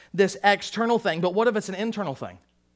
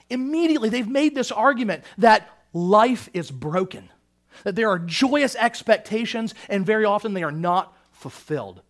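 ordinary talk

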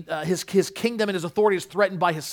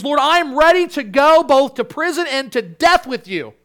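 loud, high-pitched earnest pledge; bold vow; 8 semitones higher, 9 dB louder than usual. Acted. Peter pledging loyalty to Jesus, bold vow. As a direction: declare it boldly, voice lifted and loud.

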